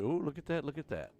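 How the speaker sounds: delighted find; excited. Real, not acted. Finds the wood he needs.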